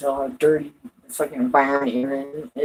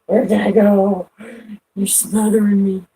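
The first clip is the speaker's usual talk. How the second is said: higher, louder smothered-cat complaint; voiced cat; 5 semitones higher, 5 dB louder than usual. Cat voiced complaining at being smothered, higher put-on protest.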